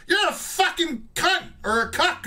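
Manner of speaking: high put-on sneering voice; hammed-up insult in a caller's voice; mock caller insult. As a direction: fire it off like a sore loser. Losing caller insults debater.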